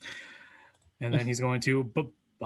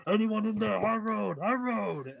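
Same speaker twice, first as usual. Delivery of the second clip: calling out; 8 semitones higher, slower than usual. Acted. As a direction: shout through the door. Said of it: "He's like".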